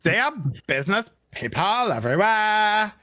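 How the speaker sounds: high, slow ditzy airhead read; bimbo voice. Acted. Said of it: "we gotta say it in bimbo voice".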